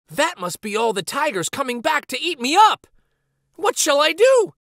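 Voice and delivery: rising panic; small boy panicking about the tigers; frightened. Acted. Samba fears tigers returning.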